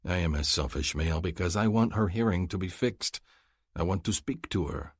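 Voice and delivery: usual speaking voice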